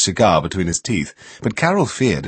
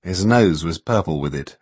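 plain reading voice

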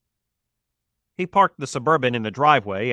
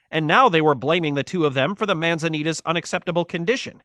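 plain reading voice

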